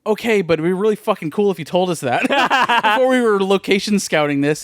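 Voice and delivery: high-pitched retold exasperation; mock pissed-off. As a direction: imitate an annoyed director, half amused. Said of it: "kinda pissed about it".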